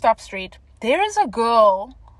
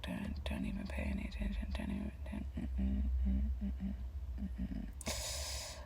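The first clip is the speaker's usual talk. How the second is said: hushed whisper fading into tiny mm sounds; very low, soft mumbled whisper; whispered self-warning; 18 dB quieter than usual. Retold or imitated. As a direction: whisper urgently to yourself, barely audible. Muttering to self to ignore him.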